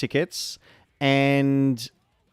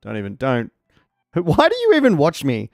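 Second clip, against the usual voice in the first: ordinary talk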